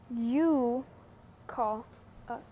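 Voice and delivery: usual speaking voice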